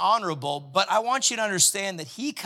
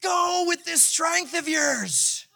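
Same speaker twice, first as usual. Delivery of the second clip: high, slow proclaiming shout; booming angel; 11 semitones higher, slower than usual. Pretend. Angel answering Gideon's whining, a high slow shout. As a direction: call it out like a herald. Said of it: "angel of the Lord's like".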